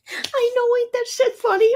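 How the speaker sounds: high, giddy, still laughing. Real, not acted. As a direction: squeak it out, amused at yourself.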